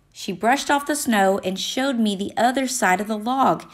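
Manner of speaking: ordinary delivery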